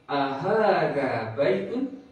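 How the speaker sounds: ahada baitun slow, rising at baitun; question tune. Shown as a demonstration. Teacher models a question for students to repeat. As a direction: ask ahada baitun with a clear rise. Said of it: "Kalimat pertanyaan kedua".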